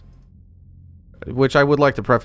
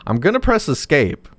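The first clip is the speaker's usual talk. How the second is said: ordinary talk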